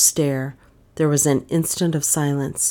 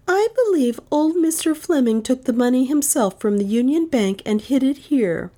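put-on lightly raised young woman's voice; Dale's admission; 8 semitones higher than usual. Dale telling the detective her theory in a lightly higher voice. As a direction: confide it, a little higher.

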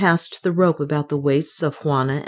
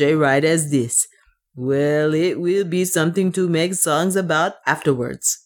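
put-on accented dwarf, bravely rueful; dwarf servant, wry. The dwarf readies for the ride, wry accented voice.

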